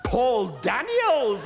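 slow, high excited crowd cry; the amazed crowd calls the name out slowly. Acted. Crowd shouts the name, stretched and high. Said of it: "they cried".

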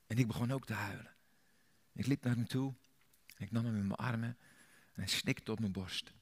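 ordinary talk

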